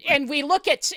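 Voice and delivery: ordinary talk, nothing marked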